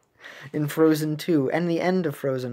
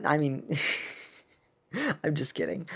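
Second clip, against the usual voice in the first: ordinary talk, nothing marked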